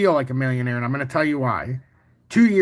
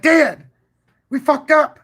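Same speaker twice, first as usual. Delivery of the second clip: retold cry to dad, high and loud; exasperated; 11 semitones higher, 7 dB louder, slower than usual. Telling dad on the phone they missed the buy, high and loud. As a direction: hit it like the trade just slipped away.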